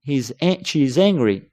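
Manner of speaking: normal talking voice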